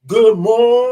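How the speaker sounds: Good morning called out loud and punchy; loud greeting. Shown as a demonstration. Host showing a new viewer how the greeting is said, loud. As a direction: say it big, like you mean it. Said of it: "We say it like that".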